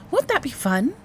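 high, coaxing excited question; infused enthusiasm. A narrator's reading. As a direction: coax a child with put-on excitement. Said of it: "infusing her voice with enthusiasm again".

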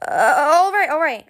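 high, loud whine; whining. A narrator's reading. Pinned captive gives in, whining. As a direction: cave in with a drawn-up whine. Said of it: "she whined".

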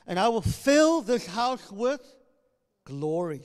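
loud, slow prophetic declaration; full preaching proclamation. Acted. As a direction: thunder it from the pulpit.

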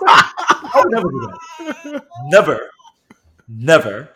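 loud, emphatic joking denial; hammed-up righteous outburst; mock indignation. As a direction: declare it loudly, half laughing, then hammer each word. Mock-indignant denial after sexism joke.

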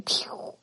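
breathy toy-gun pew, very soft; whispered pew. Retold or imitated. Imitating her son's finger gun, a whispered pew. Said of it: "making guns with his finger and going".

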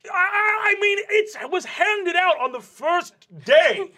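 jealous, cracking protest; flustered vice principal. Acted. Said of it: "Goldenhorn says".

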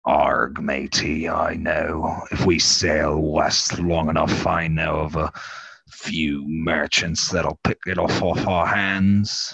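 put-on low, slow old-salt pirate voice; deep pirate growl, words dragged out; pirate character. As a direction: draw it out like a pirate captain. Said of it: "I like this character".

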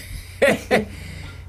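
airy giggle; quick unvoiced giggle; high breathy laugh. Real, not acted. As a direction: let a high laugh slip out.

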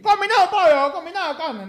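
high-pitched put-on Chinese accent; mock chifa accent. Chifa worker listing combinado dishes, high mock Chinese accent. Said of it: "en un idioma chifero".

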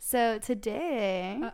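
very slow, stretched words; slow motion. Pretend. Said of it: "talk in slow motion for this".

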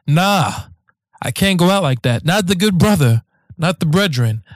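ordinary delivery

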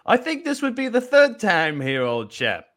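high, posh mock English accent; hammed-up British gent; a playful fake English accent. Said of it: "Well, you're gonna do that".

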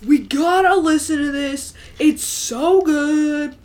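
high, loud party college dude; surfer dude voice. Retold or imitated. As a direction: hype up the frat bro, shouting it out. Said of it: "sound like a surfer dude".

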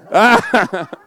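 loud, high burst of laughter; big amused cackle; real laughter. Real, not acted. Laughs at getting into trouble.

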